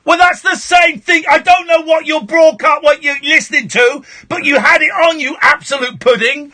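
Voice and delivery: high, loud, furious shock-jock tirade; shouted rant. Real, not acted. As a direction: bawl out the caller and dismiss him. Host rants and dismisses caller.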